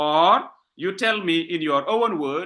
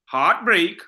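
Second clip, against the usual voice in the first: "heartbreak" said firmly, HEART and BREAK equal; both syllables stressed. Shown as a demonstration. Teacher showing all syllables stressed, "heartbreak" evenly weighted. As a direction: hit both halves of "heartbreak". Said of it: "All of them are stressed".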